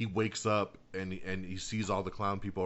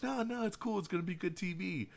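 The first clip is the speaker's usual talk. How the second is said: placating; 9 semitones higher, 5 dB quieter than usual. Retold or imitated. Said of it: "And they're like".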